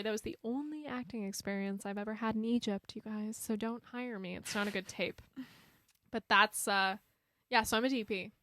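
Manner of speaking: normal talking voice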